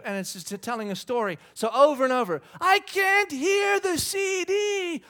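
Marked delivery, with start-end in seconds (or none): 2.6-5.0 high, loud, drawn-out whining yell; imitated child screaming. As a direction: holler slowly like a small boy in the back seat. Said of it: "all he's doing is screaming this".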